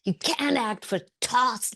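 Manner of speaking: imitated angry telling-off, pitched high; laying into her. Filmmaker insulting an inexperienced actress in rehearsal, imitated angry scolding. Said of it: "he laid into her and insulted her".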